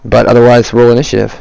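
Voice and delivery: usual speaking voice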